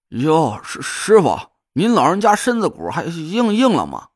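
tipsy, tongue too thick; slurred drunk stammer; drunk slurring. Acted. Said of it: "舌头很大，估摸着是喝了不少".

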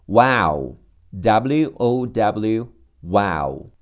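normal talking voice

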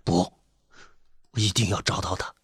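low, quiet determination; soft but unshakeable resolve; firm, resolute. A narrator's reading. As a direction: allow no argument, without raising the voice. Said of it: "他的语气很坚决，不容置疑".

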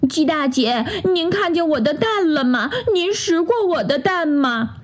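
high, worried little duck voice; a fretful mother duck searching; anxious duck mother. Acted. Duck mother asks the hen. (着急找蛋的鸭妈妈，高声询问)

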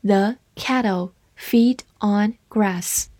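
slow, careful read-aloud. Shown as a demonstration. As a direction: read it slowly for learners to follow. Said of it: "好，慢慢来读". (慢速朗读)

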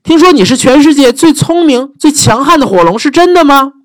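high, eager wide-eyed question; butter up the dragon; flattering princess. Acted.

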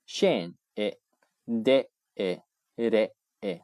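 scende，e，re，e split into very slow separate syllables. Shown as a demonstration. Teacher splitting the word for students to hear, slowly. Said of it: "你听".

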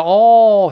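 "哦" loud and held, like a lone l; dark l as 哦. Shown as a demonstration. Teacher likening English l to Chinese, long loud "哦". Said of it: "是这么一个".

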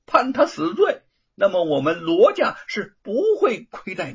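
put-on loud, high, pushy young master coaxing and threatening; half coaxing, half threatening. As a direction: lean on the magistrate, half bribe half threat. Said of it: "罗庞半软半硬地说".

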